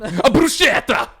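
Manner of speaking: loud, high, exaggerated Italian pronunciation; Giada De Laurentiis. Mocking a TV cook's bruschetta, loud showy Italian. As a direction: belt out the word in showy Italian. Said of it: "hear fucking Giada De Laurentiis say it".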